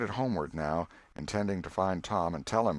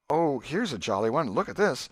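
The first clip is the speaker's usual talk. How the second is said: much higher, eager and pleading; eager exclaiming; 7 semitones higher, 4 dB louder than usual. Acted. Alfred showing Becky pictures, eager high exclaiming. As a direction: try to win her back with delight. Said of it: "kept exclaiming".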